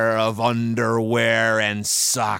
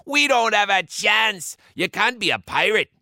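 high, fretful crewman; worried pirate losing heart; panicky pirate; 8 semitones higher than usual. A narrator's reading. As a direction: protest in a hammed-up panic.

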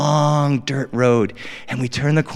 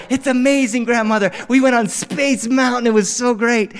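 put-on excited kid; 8 semitones higher than usual. Happy kid tells grandmother.

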